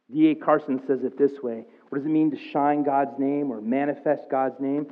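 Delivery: usual speaking voice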